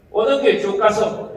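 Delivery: loud, slow Gyeongsang dialect, sounding angry; gruff Gyeongsang directions. Retold or imitated. Local giving a lost visitor directions, gruff Gyeongsang dialect. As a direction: bark directions in Gyeongsang dialect like you're annoyed. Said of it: "깜짝 놀랍니다 화내는 줄 알고".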